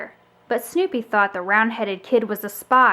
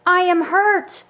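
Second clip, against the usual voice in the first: crying out hurt; 7 semitones higher, 4 dB louder than usual. A narrator's reading. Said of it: "Snoopy cried".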